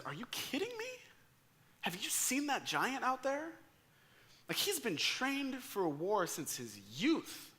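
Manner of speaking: put-on stunned doubt, as if the boy is mad; incredulous Saul. Saul doubting the teenage David, high incredulous voice.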